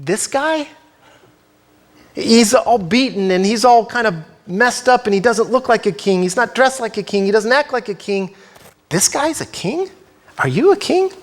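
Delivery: a lightly voiced Pilate, high and scoffing on "This guy?"; mock disbelief, rising to a high squeak at the end; incredulous Pilate. Pilate scoffs at Jesus, lightly voiced incredulous question. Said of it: "emphatic in a sense of implying".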